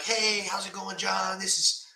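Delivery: chirpy telemarketer hello, hammed-up; upbeat salesman. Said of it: "This upbeat all the time, like, salesman".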